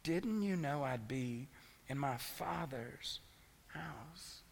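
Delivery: slow, soft, tender reading; tender emphasis. Shown as a demonstration. Preacher savouring Jesus's words to his parents, slow and soft. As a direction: linger on each word softly. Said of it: "He uses relational language".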